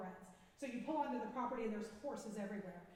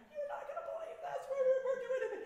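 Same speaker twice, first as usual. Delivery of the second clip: thrilled; 13 semitones higher, 7 dB louder than usual. Retold or imitated. Retelling her own thrilled reaction.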